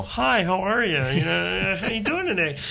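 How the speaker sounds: high, sugary greeting in parody; mock social niceties. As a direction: chirp a fake-friendly hello to a customer. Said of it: "there's no, like, you know".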